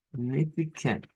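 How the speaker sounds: ordinary talk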